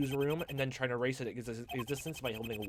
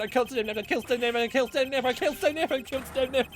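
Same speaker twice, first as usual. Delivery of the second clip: each repeat higher and louder, shrieking; building hysterical scream; 12 semitones higher, 10 dB louder than usual. Acted. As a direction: climb into a frenzy.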